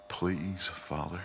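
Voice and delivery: soft, hushed imploring prayer; imitating a quietly reverent prayer; tender, gentle plea. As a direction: plead gently, almost fading to a whisper. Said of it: "tender, gentle manner she had".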